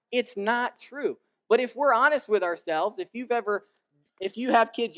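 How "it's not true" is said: dragged out slowly, high and loud; slow pleading denial. Retold or imitated. Son repeats it to his dad, slowly and pleading. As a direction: stretch every word of it's not true. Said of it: "and I think".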